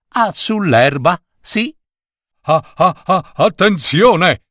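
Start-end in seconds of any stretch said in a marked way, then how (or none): 2.4-4.4 high, drawn-out call of warning; warning cry. A narrator's reading. Someone suddenly warns the picnicking passengers, cried-out warning. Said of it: "gridò ad un".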